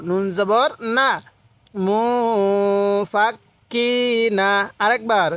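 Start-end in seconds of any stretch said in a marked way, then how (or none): 1.9-4.5 syllable-by-syllable lesson chant with long মু; model recitation. Shown as a demonstration. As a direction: hold মু, clip into ফাক, lengthen কি. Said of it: "এরকমভাবে শিখব".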